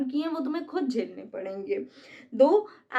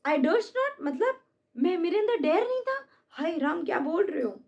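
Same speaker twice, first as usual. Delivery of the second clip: shocked indignation; 5 semitones higher than usual. Acted. Cassius shocked at Brutus's taunt.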